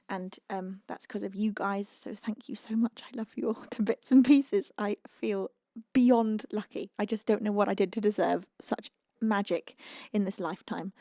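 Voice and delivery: ordinary talk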